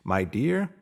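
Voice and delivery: ordinary delivery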